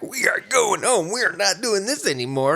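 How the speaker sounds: high-pitched, exasperated retold ultimatum; fed-up parent. Dad to crying son at the toilet, fed up. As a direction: lay down the law, at wit's end.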